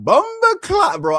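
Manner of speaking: high, slow Jamaican drawl; Jamaican accent. Retold or imitated. Coworker praising the car, high Jamaican accent. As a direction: drag bumper clock out high and slow. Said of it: "he was like".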